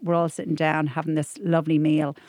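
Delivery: usual speaking voice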